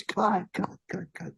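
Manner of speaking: soft, high-pitched repeated calls; imitated director's cut. Director calling cut, retold.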